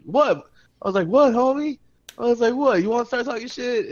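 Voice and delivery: confrontation. Retold or imitated. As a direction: square up to them. Confronting guys talking shit.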